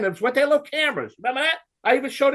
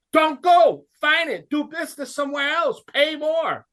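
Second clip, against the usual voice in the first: ranting orders; 8 semitones higher than usual. Real, not acted. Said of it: "this rant".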